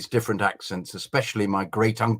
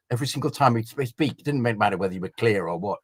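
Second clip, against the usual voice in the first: usual speaking voice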